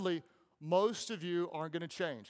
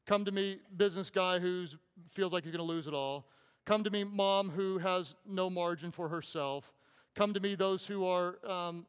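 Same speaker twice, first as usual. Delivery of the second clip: louder, rising preacher's call to the weary; preaching at full force; 2 semitones higher, 4 dB louder than usual. Acted. Pastor to the weary crowd, a loud impassioned preaching call. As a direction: pour out the invitation with passion. Preacher voicing Jesus' invitation.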